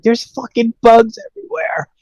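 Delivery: high-pitched imitation of a panicked kid; mock screaming. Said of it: "the one kid woke up screaming".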